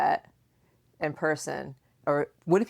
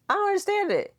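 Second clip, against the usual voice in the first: exasperated; faster than usual. Real, not acted. Mother exasperated about math.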